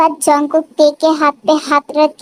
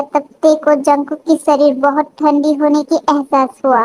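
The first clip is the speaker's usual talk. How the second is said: plain reading voice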